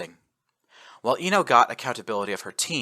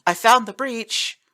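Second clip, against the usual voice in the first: much higher, louder, slow call across the room; 13 semitones higher, 6 dB louder than usual. Acted. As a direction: call out to the squad. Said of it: "called out the other female Marine".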